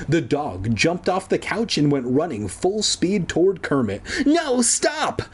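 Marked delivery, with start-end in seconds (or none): 4.3-5.2 high-pitched cry to stop; panicked cry. A narrator's reading. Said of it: "Kermit cried".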